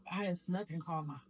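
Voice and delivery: ordinary talk, nothing marked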